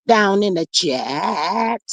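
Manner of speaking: very slow, lingering on "chat"; drawn-out greeting. Real, not acted. Host greeting everybody down in the chat, drawn out. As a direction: stretch CHAT out long and slow.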